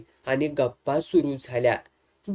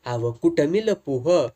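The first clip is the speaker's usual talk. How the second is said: plain reading voice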